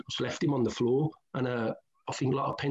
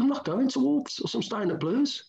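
defiant refusal; 12 semitones higher than usual. Retold or imitated. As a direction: plant your feet and refuse outright. Retold defiant refusal to the manager.

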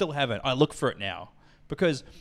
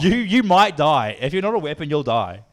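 loud slow warning; 2 semitones higher, 7 dB louder than usual. Real, not acted. Warning friend about drinking sanitizer.

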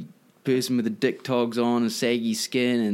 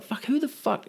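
incredulous; 12 semitones higher than usual. Retold or imitated. Exasperated quote of himself.

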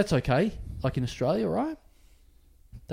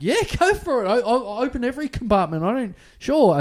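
higher, with a laugh, overly helpful; nervous eager compliance; 10 semitones higher, 5 dB louder than usual. Retold or imitated. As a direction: show you have nothing to hide. Over-eager compliance with police.

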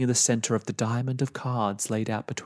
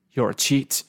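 loud, bitter accusation; accusing; 4 dB louder than usual. Acted. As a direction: spit out the charge. Man accuses old woman.

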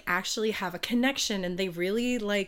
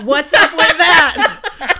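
slow, much higher and louder meme voice, laughing; hammed-up catchphrase; 8 semitones higher, 13 dB louder, slower than usual. Echoing her friend's meme line, slow and loud. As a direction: belt the catchphrase through a laugh.

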